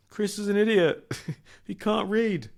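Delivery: mock him in a high voice; imagined mocking thought. Imagined classmates sneer that Chris can't read, high and mocking. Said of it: "what everyone must have thought".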